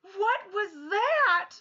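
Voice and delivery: high, slow, loud bewildered "what was that"; slow shocked disbelief; stunned question. Retold or imitated. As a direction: ask it as if a honk just startled you. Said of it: "I was like".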